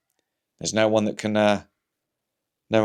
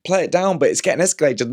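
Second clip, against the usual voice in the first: ordinary talk, nothing marked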